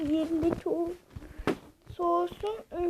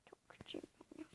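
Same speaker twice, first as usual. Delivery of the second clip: whispered to self; 25 dB quieter, faster than usual. Acted. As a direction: breathe it out as a whisper. Whispered aside.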